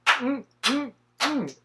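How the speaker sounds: high, slow, sassy purring; sassy diva voice. Acted. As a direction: coo over the look like a diva. Diva admiring her final look.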